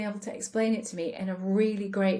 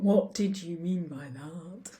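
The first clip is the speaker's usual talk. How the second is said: bad tone; slower than usual. Shown as a demonstration.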